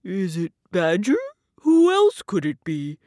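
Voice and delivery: lightly voiced storybook bear; guessing bear. A narrator's reading. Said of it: "Bear says".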